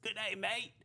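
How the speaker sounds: a hint of a put-on Australian accent. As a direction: toss out a mock Aussie hello. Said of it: "he likes doing his Australian accent".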